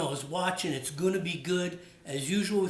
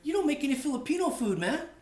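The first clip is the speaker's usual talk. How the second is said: higher, quick teasing imitation of a friend; Pabs; 10 semitones higher than usual. Friend Pabs teasing the host about no Filipino food, quick raised imitation. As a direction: needle him fast in a raised buddy voice. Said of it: "So he goes".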